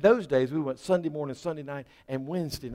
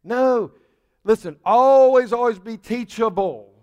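much louder and higher, insistent; emphatic preaching; 6 semitones higher, 8 dB louder than usual. Real, not acted. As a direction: hammer it home to the congregation. Preacher driving the point home.

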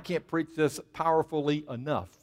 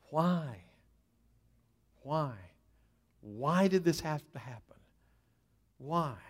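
soft, spaced-out plea; hushed anguished questioning; 9 dB quieter than usual. Acted. Struggling believer asks God over and over, hushed questioning. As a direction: ask softly, letting each question hang.

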